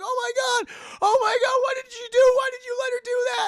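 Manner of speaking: high, loud, rapid horrified hollering; imitated hysterical screaming. Storyteller voicing British couple confronting villagers, hysterical mock screaming. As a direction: shriek it like a witness losing control. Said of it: "they're screaming, they're hollering".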